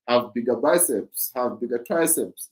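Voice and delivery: usual speaking voice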